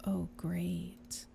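a hushed conscience pleading; whispering. Acted. Angel whispering.

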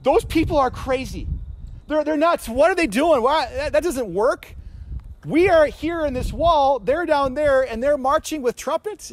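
high, incredulous jeering from the wall; mocking Jericho townsfolk. Jericho people watching Israel march with trumpets, high mocking disbelief. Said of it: "they're probably saying".

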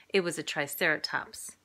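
plain reading voice

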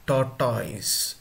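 "tortoise" said wrongly as tor-TOYS; mispronounced tortoise. Shown as a demonstration. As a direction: end "tortoise" in a full "toys" sound. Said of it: "don't pronounce".

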